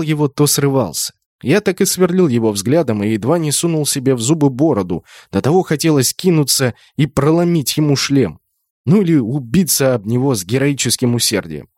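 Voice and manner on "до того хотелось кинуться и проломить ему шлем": usual speaking voice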